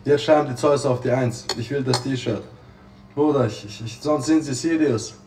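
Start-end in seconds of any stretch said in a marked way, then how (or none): none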